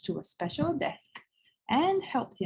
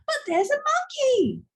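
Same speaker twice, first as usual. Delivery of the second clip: put-on high excited little boy's yell; a child insisting loudly; 4 dB louder than usual. A narrator's reading. Boy in story hour yelling about the monkey, high and excited. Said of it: "yelled the boy".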